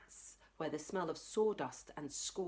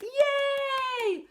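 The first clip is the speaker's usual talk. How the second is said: cheer; 8 dB louder than usual. Acted. Kids clapping.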